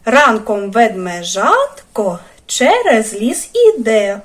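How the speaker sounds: put-on slow, rhythmic rhyme for children; rhyme recital. Teacher reciting a rhyme to young children, slow and rhythmic. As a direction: chant it slowly, beat by beat.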